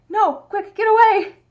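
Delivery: loud, high mock alarm; mock panicked shout. Owner voicing her cat's panic at the door, loud and high. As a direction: yell a hammed-up warning to flee. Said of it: "and I was like".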